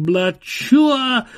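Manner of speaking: high, loud firework noise; exploding fireworks. A narrator's reading. Fireworks over the merry-go-round, loud high imitated burst. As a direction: explode it high, then fall off. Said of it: "Carnival fireworks exploded".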